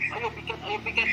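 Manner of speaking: very high-pitched imitation of daily nagging; exaggerated, high mock reminder; nagging teacher. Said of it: "Masa setiap hari saya harus ngasih tahu".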